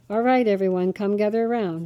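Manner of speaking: ordinary delivery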